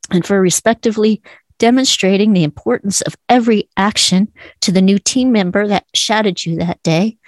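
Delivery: ordinary talk, nothing marked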